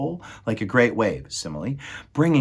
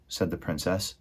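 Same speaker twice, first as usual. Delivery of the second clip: plain reading voice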